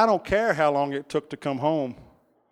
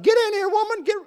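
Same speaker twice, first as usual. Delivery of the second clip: high, loud holler to wife; urgent high-pitched summons; calling out; 5 dB louder than usual. Retold or imitated. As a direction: holler for her to come in.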